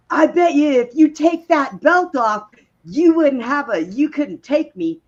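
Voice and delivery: high, loud mocking challenge from a drunk prisoner; taunting drunk girl. Prisoner taunting the officer through the cell bars, high mocking dare. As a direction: sneer it up high and loud like a bar-fight drunk. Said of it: "So she goes".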